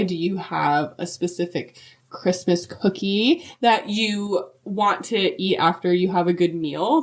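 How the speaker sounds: ordinary talk, nothing marked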